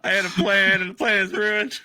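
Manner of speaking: high, loud theatrical lament in character; dramatic despair. Acted. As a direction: wail over the ruined plan. Grim, what's wrong?.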